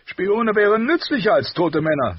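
loud, high, blustering king voice; gruff king. A narrator's reading. Robert voiced in audiobook.

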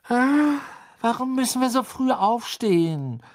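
high, groaning early-morning whine; sleepy complaint. Acted. Ginny complains at being woken early, sleepy high whine. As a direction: moan about getting up, pitched high and loud.

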